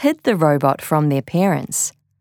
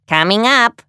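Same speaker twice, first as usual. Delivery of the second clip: higher, lightly voiced eager robot; robot obliging an order; robot character; 5 semitones higher, 4 dB louder than usual. A narrator's reading. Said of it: "said Tidybot".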